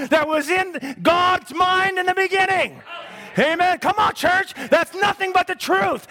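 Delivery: high, shouted revival preaching; pulpit-pounding sermon voice; fiery preaching. Acted. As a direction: preach it out full force to the church. Preaching at full force.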